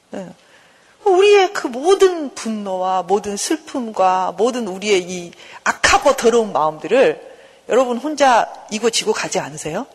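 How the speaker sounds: ordinary talk, nothing marked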